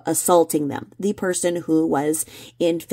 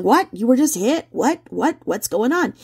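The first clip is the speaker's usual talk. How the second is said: mock confused bystanders; 7 semitones higher than usual. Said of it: "people around are going".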